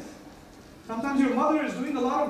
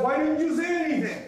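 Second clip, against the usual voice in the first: higher-pitched, pleading question; acted reproach; 6 semitones higher than usual. Son to father who was always proud, acted reproach. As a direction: imitate a hurt son protesting.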